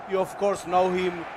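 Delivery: ordinary talk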